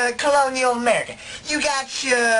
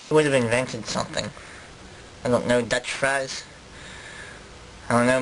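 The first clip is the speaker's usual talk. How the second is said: low, hesitant musing; Dutch interviewee; 9 semitones lower, 3 dB quieter than usual. Acted. Colonist guesses at inventions for interviewer, low and hesitant. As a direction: think aloud in a low character voice.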